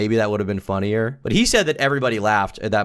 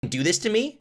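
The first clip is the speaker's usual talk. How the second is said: imitating angry comic; 8 semitones higher than usual. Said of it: "very genuinely angry at me".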